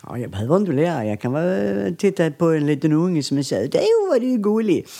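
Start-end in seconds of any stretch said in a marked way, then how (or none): 3.7-4.9 Skåne accent. Shown as a demonstration. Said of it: "vrider på tungan lite så blir det skånska".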